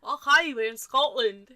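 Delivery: loud, hammed-up Scottish accent; bad Scottish accent. Co-host quoting Doctor Who, loud bad Scottish accent. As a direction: throw out a clumsy, booming Scottish line. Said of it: "the only Scottish I can do".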